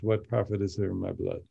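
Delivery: "What profit is there in my blood?" rattled off fast and soft, no beat; quick prose reading. Shown as a demonstration. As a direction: toss it off as plain prose. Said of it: "It sounds like prose".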